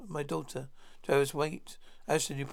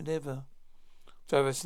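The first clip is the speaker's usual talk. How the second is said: ordinary delivery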